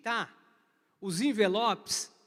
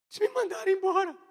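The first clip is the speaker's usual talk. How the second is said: high, louder tearful wail, fast; crying complaint; 8 semitones higher, 6 dB louder, faster than usual. Retold or imitated. The office boy tearfully telling the boss he was fired. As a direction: blurt it out through tears.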